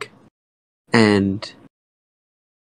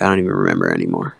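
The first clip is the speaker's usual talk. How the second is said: much lower, fast, tired little character voice; Crybaby's voice; 4 dB quieter, much faster than usual. Retold or imitated. Mom's bedtime story, Crybaby answering why he cries, low and quick. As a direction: mumble it low and quick like a worn-out storybook child. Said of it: "And Crybaby would be like".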